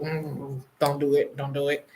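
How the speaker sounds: soft, low don't do it; teasing self-warning. Pretend. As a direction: warn yourself in a soft low voice. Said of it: "But I did it anyway".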